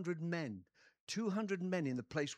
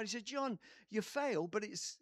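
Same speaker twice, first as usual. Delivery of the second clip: normal talking voice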